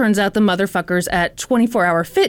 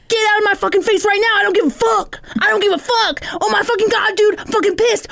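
high, fast, ranting outburst; hammed-up, repeated angry dismissal; mock fury; 10 semitones higher than usual. Gym member yelling at the manager, mock furious.

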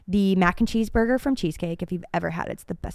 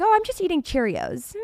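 mock casual; 7 semitones higher than usual. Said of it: "I was like".

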